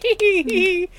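high-pitched teasing hee hee; mock giggle. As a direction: giggle like a sneaky schemer. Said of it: "I'm over here being like".

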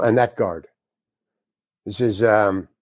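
ordinary delivery

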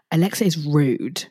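usual speaking voice

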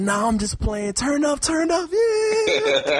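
high, rising party hype. Retold or imitated. Friend laughs off the tension, high party hype. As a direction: backpedal then hype the crowd. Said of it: "He's like".

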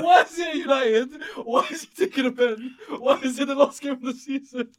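high-pitched questions piling up; laughing incredulity. Real, not acted. Friend picking holes in the absurd scenario, laughing incredulity. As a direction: squeal each question, close to breaking down laughing.

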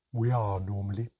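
loud, weary sighed reply; sighing. A narrator's reading. Mermaid admits her unusual size, sighing. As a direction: answer with a sigh. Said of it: "Havru said with a sigh".

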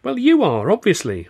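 excited cry. A narrator's reading. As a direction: burst out with the obvious answer. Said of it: "I cried".

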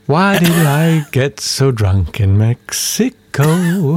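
slow, dazed hungover voice; groggy drunk. Acted. Hungover man wondering about last night, slow and dazed. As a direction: drag it out like a confused drunk.

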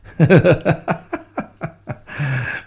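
loud, high burst of laughter; laughing out loud; big laugh. Real, not acted. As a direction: crack up at your own mistake. Laughing at own botched recital.

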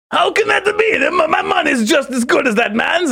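high indignant Watto cry. Retold or imitated. Protesting to the waiter in a high Watto voice. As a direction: Watto outraged he can't have the dish. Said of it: "put on the Watto voice".

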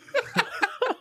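sudden high-pitched burst of laughter. Real, not acted. Teasing laugh mid-rant.

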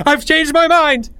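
high, loud frantic yell; panicked scream. A narrator's reading. Boy running back from the woods, screaming. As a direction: scream it out in alarm. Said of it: "he screamed".

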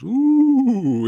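high ghostly ooh; a high haunted-house ooh; spooky ooh. Acted. Spooky Halloween intro.

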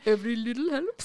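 put-on teasing copy of a German-sounding accent; mock the odd accent, amused; laughing imitation of a vaguely German accent; mimicked weird accent. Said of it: "in a weird accent".

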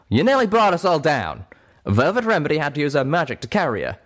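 high, loud angry accusation; Calamity lashing out. A narrator's reading. Friend blaming Little Pip for near crash, lashing out. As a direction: blast it out, then ease slightly. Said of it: "Calamity lashed out".

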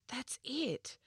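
a quick whispered discovery; girl whispering her sudden idea to her brother; excited whisper. A narrator's reading. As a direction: breathe it out in a whisper.

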